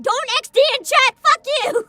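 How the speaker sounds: high, very loud outburst at chat; scolding shout. Real, not acted. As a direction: shout a furious telling-off, high-pitched.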